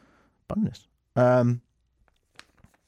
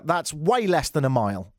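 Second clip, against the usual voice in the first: ordinary delivery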